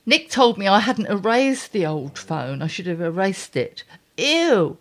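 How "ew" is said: ew. Real, not acted. Disgust at photos.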